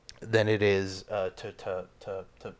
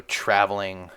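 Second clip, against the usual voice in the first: ordinary talk, nothing marked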